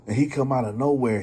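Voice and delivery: normal talking voice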